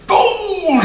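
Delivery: high-pitched gush of wonder; awed excitement. Retold or imitated. Telling the crowd about a shiny new gold coin, awed. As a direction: exclaim it like dazzled treasure-finder.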